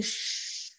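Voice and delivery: long unvoiced shush to silence a thought. Shown as a demonstration. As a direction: hush it like quieting a room. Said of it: "जैसे कि आप कहेंगे". (धीमी "श्श्श" की आवाज़)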